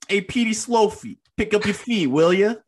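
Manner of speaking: put-on high, loud old-timey New York gangster call; 1930s gangster accent. Shown as a demonstration. Showing his cohost how to do the gangster accent. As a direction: belt out the nickname like a Roaring Twenties mobster. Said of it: "You just gotta do it, man".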